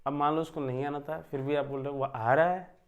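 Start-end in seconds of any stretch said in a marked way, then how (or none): 2.0-2.8 louder astonished "वह आ रहा है" with a rise on "रहा"; surprised. Shown as a demonstration. Teacher demonstrating surprise to students, louder and astonished. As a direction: say it amazed that he is coming at all. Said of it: "आश्चर्य हो जाएगा".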